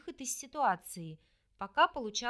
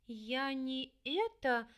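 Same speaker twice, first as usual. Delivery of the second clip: slow, faltering babble; falter, lost for words; babbling; much slower than usual. Acted. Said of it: "залепетала в ответ".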